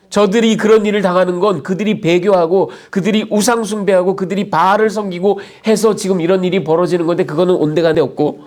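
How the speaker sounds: ordinary delivery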